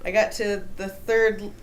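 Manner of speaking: ordinary talk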